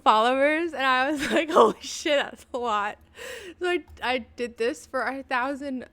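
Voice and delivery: loud, giggly excitement; laughing. Real, not acted. Streamer cracking up over a thousand followers picture, laughing. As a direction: talk through bursts of laughter.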